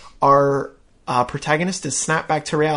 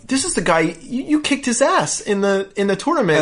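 gleeful salesman voice; 7 semitones higher than usual. Retold or imitated. Dan voiced introducing.

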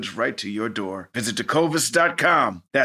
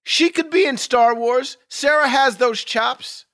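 excited; 11 semitones higher, 6 dB louder than usual. Real, not acted. Excited praise of Sarah.

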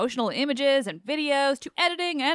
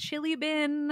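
"chili bin" said in her own accent, lightly imitating him; imitating a Kiwi phrase; 6 semitones higher, slower than usual. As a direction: try "chili bin" like a Kiwi, half-mocking. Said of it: "You say things like".

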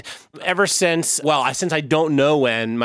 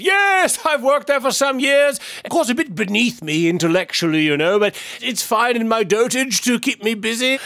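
higher, put-on old gentleman voice; old man voice; 7 semitones higher than usual. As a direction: play a vain old man in disguise, much higher. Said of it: "Forgive an old man his vanity".

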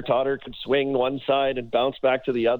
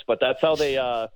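ordinary delivery

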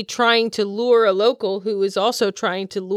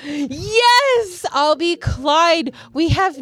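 excited; 8 semitones higher, slower than usual. Acted. Excited high reply.

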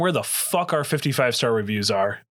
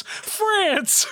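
high mock-announcer call; hammed-up name call. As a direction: shout the name like a punchline. Punchline shout of name.

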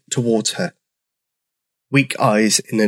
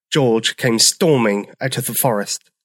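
usual speaking voice

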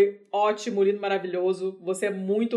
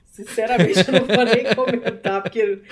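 laughing; 2 semitones higher, 8 dB louder than usual. Real, not acted. Laughing off the morbid goodbye.

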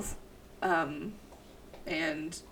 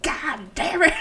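put-on grumpy old neighbor; 8 semitones higher, 7 dB louder than usual. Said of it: "and it's like".

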